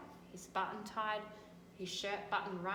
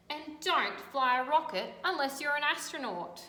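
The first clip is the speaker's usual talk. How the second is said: louder, animated read-aloud; storybook reading; 2 semitones higher, 5 dB louder than usual. A narrator's reading. Teacher reading to children, animated storytelling. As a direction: make the tale vivid for kids.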